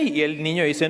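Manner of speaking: usual speaking voice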